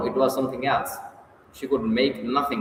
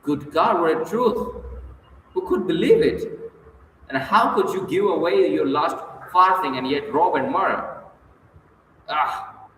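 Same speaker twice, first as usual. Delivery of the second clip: higher-pitched shocked exclamations; sudden cry; 5 semitones higher, 4 dB louder than usual. A narrator's reading. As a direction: cry out in disbelief, pitch jumping up. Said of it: "She cried suddenly".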